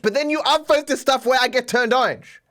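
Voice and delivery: high, half-laughing exasperation; exasperated argument. Real, not acted. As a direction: argue back in exasperation, pitch climbing. Exasperated argument with complaining viewers.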